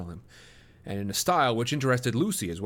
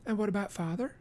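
put-on higher, soft girl's question; young woman voice; 10 semitones higher, 5 dB quieter than usual. A narrator's reading. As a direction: ask it timidly, lightly. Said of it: "she asked".

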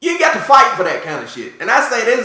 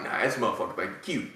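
muttered scorn; 11 semitones lower, 14 dB quieter, faster than usual. Retold or imitated. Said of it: "I was like".

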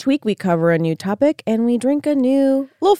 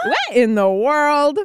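baffled; 4 semitones higher, much slower than usual. Real, not acted. Reacting to strange taste.

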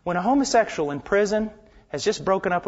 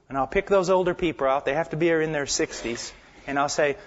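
normal talking voice

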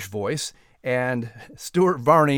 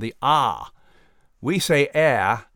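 ordinary talk, nothing marked

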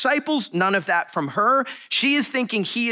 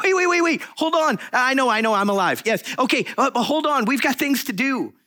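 high, rapid-fire "hold on, hold on"; acted flustered reassurance in short bursts; playful urgent protest; 5 semitones higher than usual. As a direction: fend off an overeager hugger, voice pitched up. Acting Jesus peeling Mary off him.

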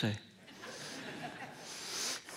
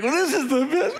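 high, blissful mock moan; hammed-up foodie rapture; moaning in delight; 9 semitones higher than usual. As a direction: gush over the food as if overcome. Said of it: "I'm moaning and everything like this".

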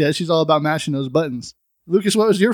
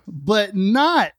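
drawn-out intro; 4 semitones higher, much slower than usual. Acted. Teasing host intro.

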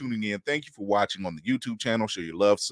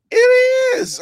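excited shout; 12 dB louder than usual. Real, not acted. Recognizing [name], delighted.

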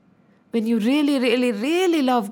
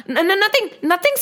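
flustered; 7 semitones higher, 3 dB louder than usual. Acted. Caught saying thought aloud.

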